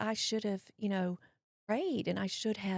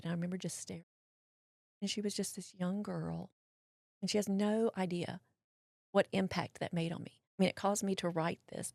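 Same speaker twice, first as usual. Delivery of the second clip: ordinary talk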